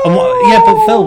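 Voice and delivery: very high loud squeak; high squeal. Pretend. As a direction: squeak it out shrilly. Squealing interruption.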